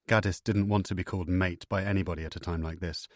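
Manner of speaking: usual speaking voice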